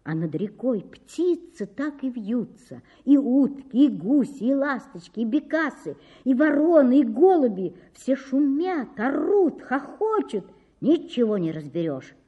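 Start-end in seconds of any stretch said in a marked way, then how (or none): none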